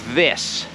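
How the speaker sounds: "This" with tongue between teeth, voiced th; correct th. Shown as a demonstration. Teacher demonstrating the th sound carefully. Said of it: "Do you see how my tongue is sticking out".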